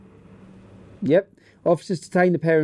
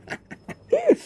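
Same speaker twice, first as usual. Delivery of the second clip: sudden high laughing burst; high-pitched laugh at the story; high burst of laughter. Real, not acted. Reacting to a news story.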